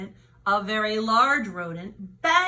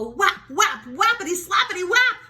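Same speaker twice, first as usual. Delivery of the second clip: rising whaps; 7 semitones higher, 5 dB louder than usual. A narrator's reading. Slapping sounds, rising.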